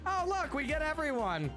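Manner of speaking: normal talking voice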